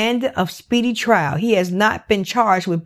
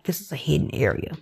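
hushed whisper, much softer; 5 semitones lower, 7 dB quieter, faster than usual. Real, not acted. Telling viewers about the hidden pond, whispered. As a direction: lean in and whisper it like a secret. Stressing the secret pond's hidden spot.

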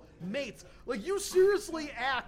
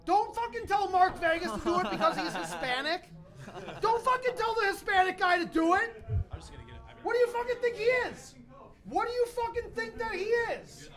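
heated rant; 6 semitones higher, 3 dB louder than usual. Real, not acted. Yelling at Chris over drinks.

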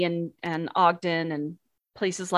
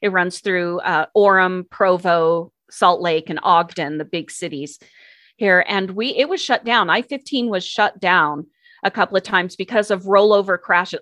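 usual speaking voice

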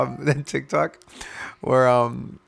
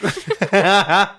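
laugh; 8 semitones higher, 5 dB louder than usual. Real, not acted. Laughing at own joke.

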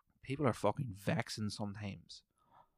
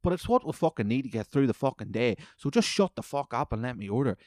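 ordinary delivery

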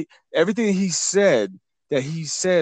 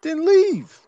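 high-pitched, louder dismissal; exasperated retort; 13 semitones higher, 4 dB louder than usual. Acted. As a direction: shoot the voice way up, incredulous. Exasperated punchline to complainers.

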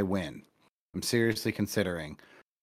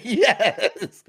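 high, laughing yes; a gleeful, laughing mock cheer; retold cheer; 12 semitones higher, 5 dB louder than usual. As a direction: squeal it out with a laugh, much higher. Retelling his delight at winning.

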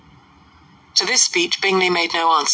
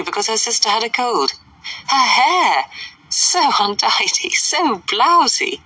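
high-pitched, catty society gossip; haughty, scandalised lady's voice, pitched up; 6 semitones higher than usual. Acted. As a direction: relish every disapproving word.

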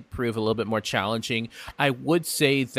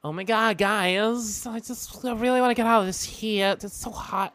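much higher, fast, swooning and dramatic; campy flamboyant voice; 10 semitones higher than usual. Acted. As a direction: whine about the heat like a diva. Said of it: "that's all I'm giving that voice".

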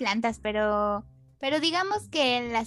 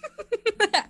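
high burst of laughter; loud laugh; 10 semitones higher, 4 dB louder than usual. Real, not acted. Laughs at the tweet joke.

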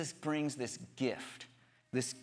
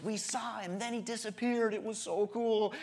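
higher, mildly excited mock disciples; excited retelling; 6 semitones higher than usual. Disciples telling stories of seeing Jesus, mildly excited. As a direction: lightly play an eager witness's wonder. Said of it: "so there's excitement".

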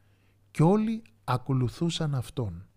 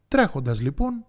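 plain reading voice

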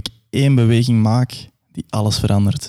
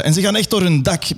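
ordinary talk

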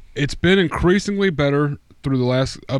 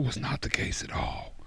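whisper; 13 dB quieter than usual. Real, not acted. Trailing off in disgust.

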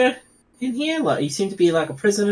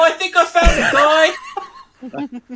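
laughing; 8 semitones higher, 4 dB louder, much slower than usual. Real, not acted. Laughing while calling to the party.

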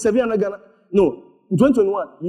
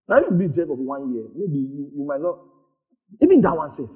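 ordinary delivery

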